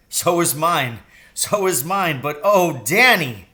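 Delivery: overjoyed father exclaiming to his son; cried out. A narrator's reading. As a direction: gush it out in delight. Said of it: "he cried".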